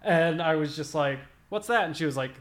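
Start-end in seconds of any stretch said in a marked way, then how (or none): 1.5-1.8 clueless question. Retold or imitated. Retold confusion at question.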